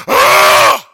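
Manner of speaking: sharp, loud gasp-yell with a laugh in it; loud cold-water yelp. Retold or imitated. Boy rising from freezing baptism water, loud shocked yelp. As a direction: yelp as icy water hits you.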